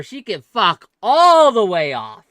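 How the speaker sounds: slow emphatic outburst. Real, not acted. Heated argument about cake.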